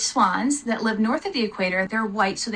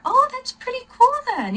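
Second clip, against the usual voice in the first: delighted surprise; 14 semitones higher than usual. Real, not acted. Reacting to viewer's reply.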